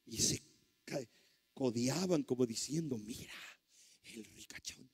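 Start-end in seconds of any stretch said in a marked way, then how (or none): 3.0-4.9 very soft, whispered aside; imitated gossipy whisper. Churchgoers nudging each other about the rich man, mock gossip whisper. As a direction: whisper it as if nudging your neighbour.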